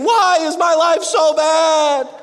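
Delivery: high, slow, loud mock whine; whiny complaint. David complaining to God, mock loud whine. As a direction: whine like life is unfair. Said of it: "he starts complaining".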